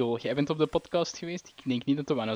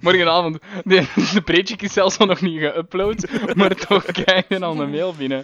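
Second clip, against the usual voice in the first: laughing; 6 semitones higher, 7 dB louder than usual. Real, not acted. Laughing at the joke.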